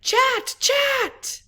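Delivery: very high, loud chattering alarm cry; monkey alarm call. Acted. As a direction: chatter it out to warn the troop. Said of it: "I yell".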